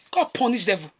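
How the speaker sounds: usual speaking voice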